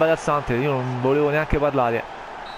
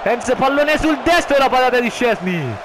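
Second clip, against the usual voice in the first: rising, louder play-by-play; excited commentary; 7 semitones higher, 4 dB louder than usual. Acted. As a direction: call the save with excitement.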